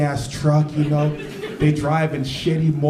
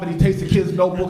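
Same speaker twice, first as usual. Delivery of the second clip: normal talking voice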